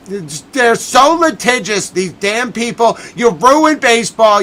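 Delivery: high, loud accusing yell; angry yell. Real, not acted. Fan yelling at litigious fans, angry. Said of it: "I've never been able to yell about that net".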